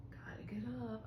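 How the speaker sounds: hushed whisper to yourself; soft retold whisper; whispered self-talk. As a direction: whisper it, acting out early waking. Acting out waking early on weekends.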